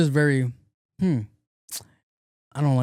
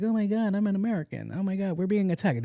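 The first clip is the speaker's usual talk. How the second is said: high mock panic, fading softer; panicked American imitation; 7 semitones higher, 5 dB quieter than usual. Host imitating panicked Americans in an invasion, higher mock panic. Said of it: "people are here like".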